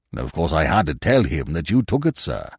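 low, pointed club attendant; reserved attendant. Acted. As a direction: confess it with cool deference. Attendant continues his cool report.

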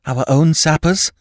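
desperate for reassurance; feverish. A narrator's reading. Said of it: "with a feverish hand".

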